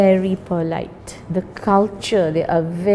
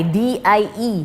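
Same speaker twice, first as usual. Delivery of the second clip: usual speaking voice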